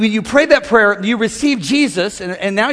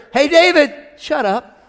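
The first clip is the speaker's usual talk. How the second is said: higher, firm calling out to David; voice of God; 5 semitones higher than usual. Retold or imitated. God correcting the preacher David. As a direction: call David to order. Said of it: "I'll hear".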